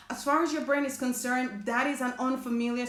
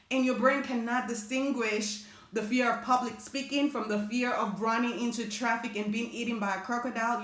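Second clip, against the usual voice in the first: ordinary delivery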